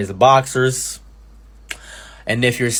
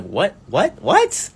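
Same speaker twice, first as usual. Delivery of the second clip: each what climbing much higher; rising disbelief; 9 semitones higher, much slower than usual. Retold or imitated. Retelling his reaction to the boss's schedule, climbing disbelief.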